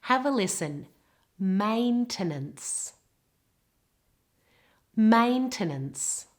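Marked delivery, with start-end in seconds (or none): 1.4-2.9 slow "Maintenance" with the weight on MAIN; "Maintenance": full A first, then two weak vowels; stress on first syllable. Shown as a demonstration. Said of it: "It's on the first part of the word". 4.9-6.3 slow "Maintenance" with the weight on MAIN; "Maintenance": full A first, then two weak vowels; stress on first syllable. Shown as a demonstration. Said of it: "It's on the first part of the word".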